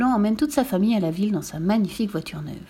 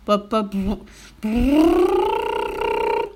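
sputters, then rising lip-trilled roar; putt-putt into a long climbing brrr; imitated engine starting; 3 semitones higher, 4 dB louder, much slower than usual. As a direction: rev up like an old motor. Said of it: "fit le moteur".

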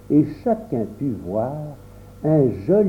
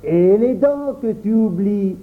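raised higher mock alarm; exclaiming, teasing; 5 semitones higher than usual. A narrator's reading. Boy adds to the teasing of Auguste, exclaiming. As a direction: call it out as a jeering reminder. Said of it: "s'écria Louis".

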